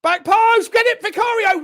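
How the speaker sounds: high, loud call to the keeper; urgent shout. Real, not acted. Commentator urging the keeper to claim it, urgent shout. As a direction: shout it out urgently as the ball arrives.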